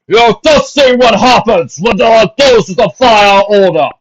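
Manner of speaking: booming captain addressing the crowd. A narrator's reading. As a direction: boom it out like a captain. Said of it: "he boomed".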